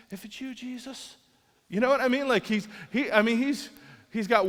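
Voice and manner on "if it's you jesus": hushed awe. Retold or imitated. Peter stepping out, hushed.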